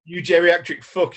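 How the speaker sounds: mock angry yell, loud and high; imitated meltdown. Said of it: "losing his shit".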